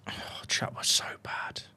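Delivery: despairing whisper to chat; hushed defeated whisper. Real, not acted. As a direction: breathe it out, crushed.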